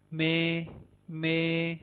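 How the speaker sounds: "मे" like English M; letter M. Shown as a demonstration. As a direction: say "मे" clearly. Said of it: "English के एम के जैसी". ("मे" अंग्रेज़ी एम जैसा)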